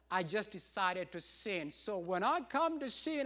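ordinary delivery

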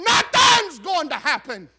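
slow, loud cry of hopelessness; despairing cry. Retold or imitated. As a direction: belt out the doubt, drawn out. Preacher voicing despairing inner thought.

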